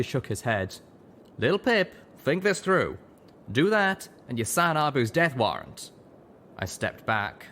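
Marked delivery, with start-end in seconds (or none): 1.4-5.9 higher, urgent drawling stallion; Calamity warning. A narrator's reading. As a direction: urge caution.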